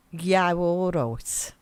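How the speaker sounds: slow, drawn-out Brummie greeting; Birmingham accent. Shown as a demonstration. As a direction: stretch it out in broad Brummie. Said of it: "What's a really good Birmingham accent".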